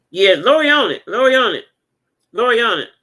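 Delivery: hyped-up cheer. Real, not acted. Cheering the viewers on about Lori, loud. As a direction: cheer her on, loud and proud. Hyping up Lori's action.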